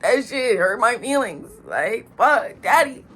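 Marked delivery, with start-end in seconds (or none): 2.2-3.0 higher, louder, hammed-up crying apology; imitating a tearful teen begging dad; mock sobbing. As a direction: wail it like a kid caught out. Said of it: "So immediately I get emotional".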